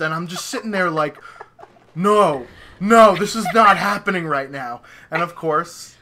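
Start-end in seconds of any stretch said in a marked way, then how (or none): 1.9-4.8 disbelief. Retold or imitated. Retelling his disbelief as messages stop.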